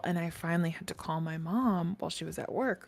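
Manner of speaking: ordinary talk, nothing marked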